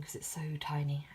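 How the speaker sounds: whispered aside; softly whispered to herself; whispering. Real, not acted. Muttering aside about her handwriting.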